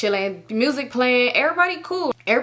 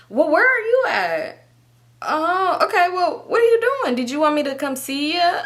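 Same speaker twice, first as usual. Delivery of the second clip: fake sweet deflection; 8 semitones higher than usual. Changing the subject on an angry boyfriend, fake sweetness. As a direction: sing it sweetly as if no one is mad.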